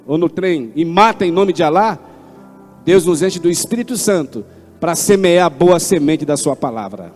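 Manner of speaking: normal talking voice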